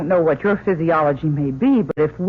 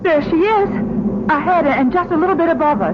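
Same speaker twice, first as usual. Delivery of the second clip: excited sighting; 10 semitones higher, 3 dB louder, faster than usual. Acted. Spotting the lab ahead.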